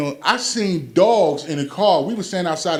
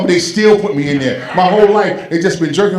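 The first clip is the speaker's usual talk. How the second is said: louder, indignant son, voice raised; son's loud complaint; 2 semitones higher, 7 dB louder than usual. Retold or imitated. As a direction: rant like a wronged son. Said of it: "He said".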